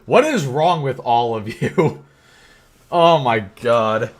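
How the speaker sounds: ordinary delivery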